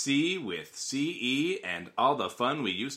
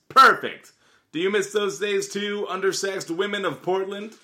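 excited ad read; 9 semitones higher, 5 dB louder than usual. Pretend. Reading a personal ad aloud.

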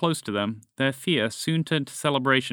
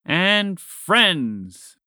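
And friends said higher and louder; loud echo; 6 semitones higher, 5 dB louder than usual. A narrator's reading. The group repeats Montgomery, loud and proud. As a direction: boom it back proudly. Echoing the whisper loudly.

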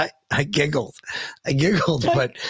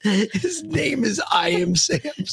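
exclaiming; 10 semitones higher, slower than usual. Real, not acted. Exasperated repeating the name.

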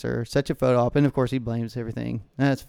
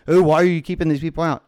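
louder, higher mocking complaint; mock yelling; 4 semitones higher, 5 dB louder, faster than usual. Congressman yelling at employee doing her job, mockingly. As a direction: mimic an indignant whiner laying into staff. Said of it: "then he's yelling at an employee".

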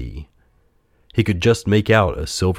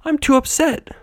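very high, protesting voice; upset puppet. A narrator's reading.

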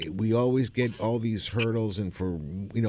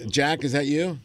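calling out; 5 semitones higher, 5 dB louder than usual. Real, not acted. Calling out to Jack.

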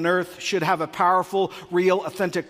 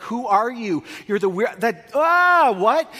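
a high, loud, stunned "that, ah, what?"; baffled what; 5 semitones higher, 4 dB louder, slower than usual. Retold or imitated. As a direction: play someone thrown by a strange enthusiast. Mock baffled reaction to list people.

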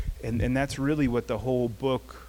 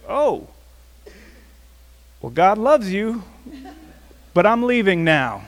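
higher, louder, laughing awkward retreat; sheepish laughing exit; flustered laugh; 10 semitones higher, 12 dB louder than usual. Retold or imitated. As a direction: back away with a nervous laugh. Retreating after being cursed at.

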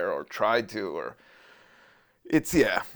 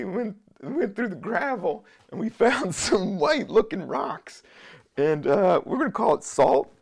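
hyped-up hustler voice; 6 semitones higher, 7 dB louder than usual. Retold or imitated. Mocking hustlers who sell gravel salt.

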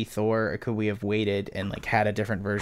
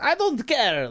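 high, dismissive mock god voice; mock Zeus; slower than usual. Fan mocking the uncaring god, high and dismissive. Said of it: "the god who's like".

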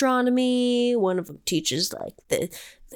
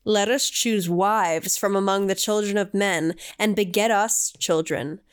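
solemn scripture reading; 3 semitones lower than usual. Acted. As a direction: intone it like ancient scripture. Reads scripture aloud.

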